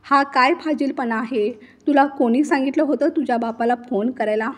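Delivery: ordinary delivery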